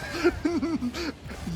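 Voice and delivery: high cackle. Real, not acted. Friends laughing at banter.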